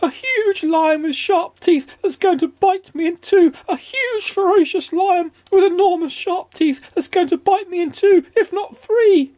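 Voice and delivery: hysterical, very high babble of fear; terrified shriek. A narrator's reading. Mister Jelly imagining a huge lion, terrified shriek. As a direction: keep squealing in mounting squeaky panic. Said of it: "shrieked Mister Jelly".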